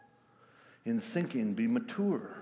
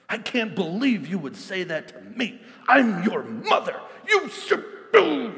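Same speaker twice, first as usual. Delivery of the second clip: mother snapping back, higher and louder; hammed-up angry mother powering up; indignant parent raising the voice; mock angry mom; 9 semitones higher, 9 dB louder than usual. Get even more angry yourself.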